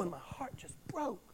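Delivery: a whispered sigh of heartbreak; heartbroken whisper. Real, not acted. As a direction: breathe it out, barely voiced.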